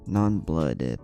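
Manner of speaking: plain reading voice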